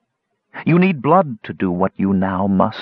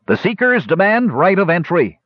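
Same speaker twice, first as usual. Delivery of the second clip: stern guard voice; 9 semitones higher than usual. Acted. Guard announces at the door.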